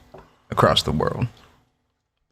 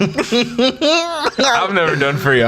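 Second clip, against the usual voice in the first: high laughing voice; 12 semitones higher, much slower than usual. Pretend. Laughing helium joke.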